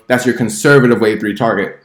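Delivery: conservative given the main weight of the line; stress on conservative. Real, not acted. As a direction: lean on the word conservative. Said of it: "I'm gonna stress the word conservative".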